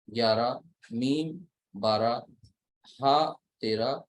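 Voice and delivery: each letter named slowly, then its number; slow counting of letters. Shown as a demonstration. Teacher counting Arabic letters for students, slow and deliberate. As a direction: spell out the letters one by one, unhurried. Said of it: "अगर आप count करिए".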